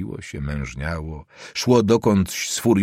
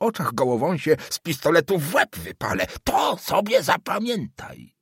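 put-on old councillor threatening the student, much higher and furious; furious old man; 8 semitones higher than usual. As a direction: hurl the threat at the young man.

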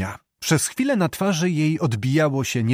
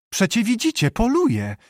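light laughing reply, higher; a teasing answer with a hint of laughter; 9 semitones higher than usual. A narrator's reading. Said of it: "śmiejąc się". (ze śmiechem, lekko i wyżej)

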